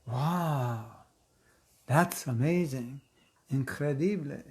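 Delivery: drawn-out wonder; awed exclamation. Shown as a demonstration. Teacher leads the class in exclaiming, awed and drawn out. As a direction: marvel at it slowly. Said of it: "Everybody say".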